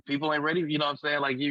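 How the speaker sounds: normal talking voice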